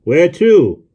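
loud, high holler; shouted question. A narrator's reading. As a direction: yell it down from the cab. Driver shouts from cab.

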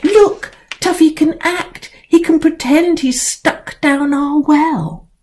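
put-on high, eager girl's voice; excited child. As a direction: show off the cat with glee. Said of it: "They were all so excited".

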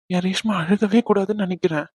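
ordinary delivery

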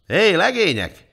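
high, loud, drawn-out hail; commanding shout for attention; calling out. A narrator's reading. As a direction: call the lads over from across the yard. King calls out to the men.

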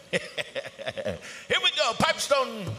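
booming high arena announcer call; powwow emcee. Acted. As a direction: belt it over the PA like an emcee. PA announcer calling the drum.

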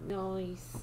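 ordinary talk, nothing marked

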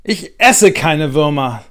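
put-on girl standing up to a teasing boy; firm refusal. A narrator's reading. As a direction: dig in and refuse each word. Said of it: "sagt Luisa".

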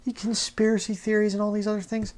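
high, mock-whiny imitation of a doubter; mocking the critics. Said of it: "You say".

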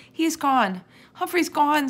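put-on high, loud, distraught child's howl. A narrator's reading. Sophie despairs over her lost hamster, howling. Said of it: "Sophie howled".

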